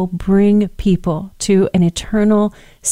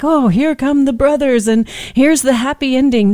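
higher, mock-cheery sing-song of a cheesy TV finale; mock TV happy ending; 6 semitones higher than usual. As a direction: sweeten it like a corny movie ending, poking fun. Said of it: "this made-for-T V moving ending".

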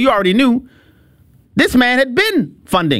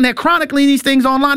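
ordinary talk, nothing marked

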